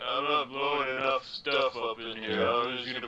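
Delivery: low-pitched mock action-star voice; Stallone. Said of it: "Stallone was in and like".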